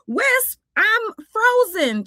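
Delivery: high, drawn-out plaintive cry; mock distress. Twink tells Wisp he is frozen, high mock distress.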